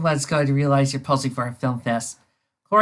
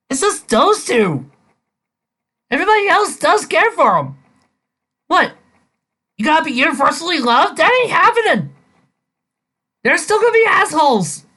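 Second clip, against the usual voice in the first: high, loud fed-up rant; exasperated rant; 12 semitones higher, 5 dB louder than usual. Real, not acted. Reviewer ranting about Davis needing universal love, high and loud. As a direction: vent at the story.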